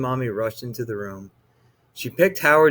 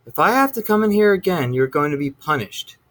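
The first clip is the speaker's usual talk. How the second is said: fast, high-pitched scolding; a fed-up mom threatening punishment; stern warning; 5 semitones higher, 4 dB louder than usual. A narrator's reading. Mother threatens son.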